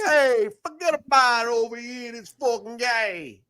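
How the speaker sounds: wiseguy brushing it off; mild mock mafioso accent; mobster Italian. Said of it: "like a guy from Italy".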